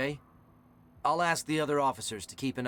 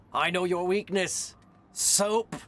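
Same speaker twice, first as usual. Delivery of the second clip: mad scientist; 6 semitones higher, 5 dB louder, slower than usual. Pretend. Gloating villain voice.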